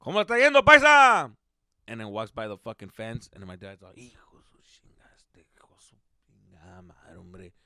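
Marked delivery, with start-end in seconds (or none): 0.0-1.3 loud, high neighborly shout; hollered greeting. Retold or imitated. Paisa neighbor greets dad while he works, hollering. 4.0-7.5 whispered cursing under the breath; dad's grumbling. Retold or imitated. Dad not in the mood as neighbor comes, whispered grumble. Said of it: "my dad was not in the mood".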